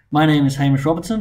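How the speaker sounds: usual speaking voice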